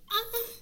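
high startled scream; scream of fright. Retold or imitated. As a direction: shriek in fright.

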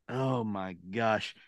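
soft, slow dismayed sigh of words. Retold or imitated. Man learning it's cash only, soft and slow. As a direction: let it sink in quietly.

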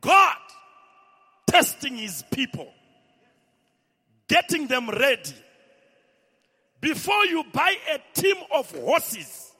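preaching at full force. Acted. Pastor proclaiming God's testing to the church, shouting. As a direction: thunder each phrase out.

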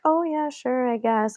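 soft, meek agreement; backing down. Retold or imitated. Two friends yield to the wife, retold meekly. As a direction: give in quietly without a fight. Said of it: "we just completely backed down".

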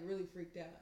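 normal talking voice

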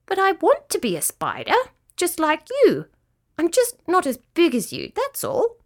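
high, small protesting voice; high-pitched, stubborn little creature; insistent little cricket. Acted. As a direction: plead your case as a tiny young cricket.

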